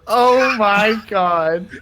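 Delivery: laughing disbelief. Real, not acted. Reacting to the dice roll.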